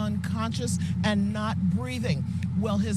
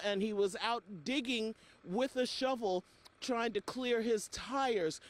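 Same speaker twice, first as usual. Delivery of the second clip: ordinary talk, nothing marked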